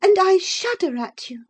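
high, repelled comeback; sharp retort. Acted. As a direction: retort with heated disgust. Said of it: "retorted Isabella".

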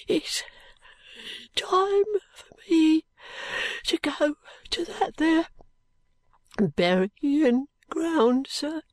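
put-on high, slow, faint Cockney boy; frail boy gripped by a wild urge; wild-eyed dying boy. A narrator's reading. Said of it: "he returns with a wild look".

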